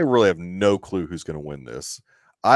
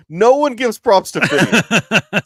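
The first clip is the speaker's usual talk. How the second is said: mock outraged lament; 13 semitones higher, 8 dB louder, slower than usual. Mock lament about [name].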